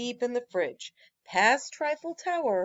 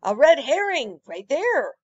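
louder pointing-out cry; mild storybook exclamation; excited cry; 4 semitones higher, 8 dB louder than usual. Acted. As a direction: call out the find eagerly. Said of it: "cried Croissant".

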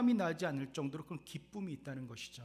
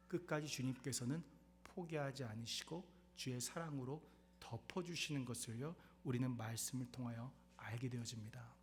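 ordinary talk

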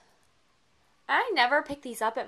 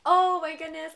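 surprised; 6 semitones higher, 9 dB louder than usual. Real, not acted. Discovers another gift.

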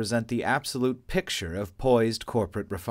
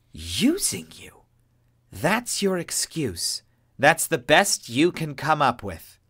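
slow scornful, rising to a louder sneer; losing patience; 6 semitones higher, 4 dB louder, slower than usual. A narrator's reading. Adele mocking Cavendish's excuse, scornful then louder. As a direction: scoff in disbelief. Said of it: "Adele asked, reaching end of patience".

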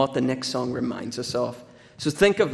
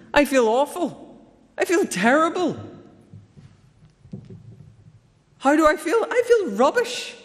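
much higher, exasperated mock rant; grumpy churchgoer rant; 11 semitones higher than usual. Preacher voicing a grumpy churchgoer at the host, hammed-up exasperated rant. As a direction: vent like a worn-out grump on Sunday morning. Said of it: "he was like".

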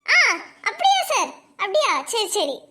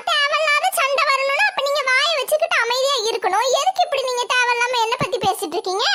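ordinary delivery

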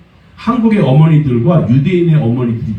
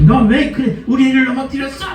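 high, mock-indignant scolding; mother scolding the ground; 11 semitones higher than usual. Korean mom scolding the ground for tripping her child, high mock scolding. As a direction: play a fussing mom blaming the floor. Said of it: "막 이래요, 또".

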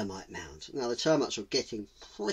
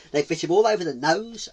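usual speaking voice

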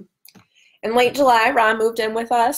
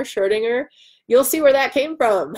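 normal talking voice

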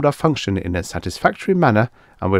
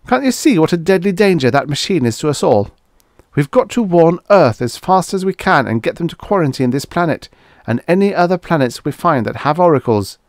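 desperate; 7 semitones higher, 3 dB louder than usual. A narrator's reading. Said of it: "Farnham turned desperately to Bates".

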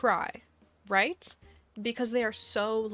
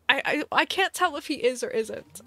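exasperated; 5 semitones higher, 6 dB louder than usual. Real, not acted. Exasperated stammering.

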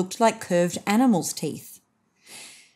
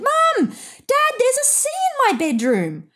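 put-on high, excited child shouting to his parents; shouting with delight, much higher; 12 semitones higher than usual. A narrator's reading. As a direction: call out thrilled from the waves. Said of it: "came up for air and shouted".

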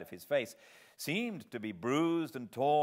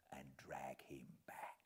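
whispered threat; 8 semitones lower, 18 dB quieter than usual. Acted. Convict's threat trails off.

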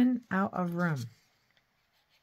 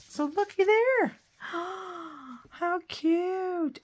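delighted gasp; 11 semitones higher, much slower than usual. Real, not acted. Crafter showing off her finished card, squealing with delight. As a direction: squeal over how cute it is.

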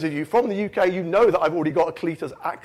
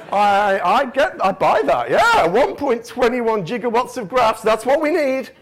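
loud, higher mock buy-in; won-over enthusiasm; 5 semitones higher, 4 dB louder, slower than usual. Audience buys the speaker's gigawatts pitch, excited and loud. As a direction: play an audience eagerly swallowing it.

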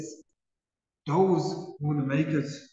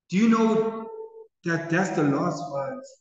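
ordinary talk, nothing marked

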